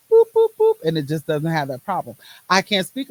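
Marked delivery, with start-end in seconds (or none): 0.1-0.8 three loud, high boops; quick sound effect, high and punchy; high playful boops. Retold or imitated. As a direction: pop out bouncy little noises. Imitates problems being brushed off.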